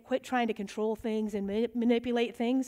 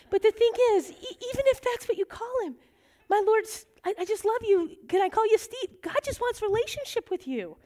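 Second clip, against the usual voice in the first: raised, much higher earnest preaching; 10 semitones higher than usual. Real, not acted. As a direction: lift it up to reach the back row. Preaching at full pitch.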